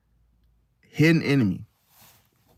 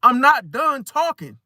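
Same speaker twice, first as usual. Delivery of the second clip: insistent; 9 semitones higher than usual. Retold or imitated.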